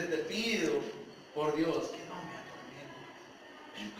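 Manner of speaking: hushed, low and drawn-out mock begging; retold plea. Demon begs Jesus not to torment him, retold as a hushed slow plea. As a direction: sink into a slow, soft imitated plea. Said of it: "Fíjense lo que está pidiendo".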